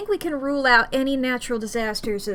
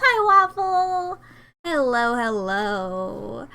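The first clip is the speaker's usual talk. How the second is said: excited greeting; slower than usual. Real, not acted. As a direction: call out the greeting slowly, sing-song. Greeting a viewer by name.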